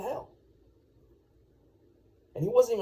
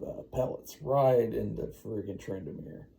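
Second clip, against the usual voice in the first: plain reading voice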